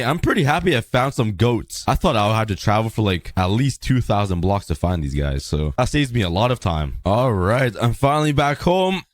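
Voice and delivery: normal talking voice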